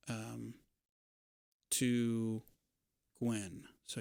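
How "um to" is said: ordinary talk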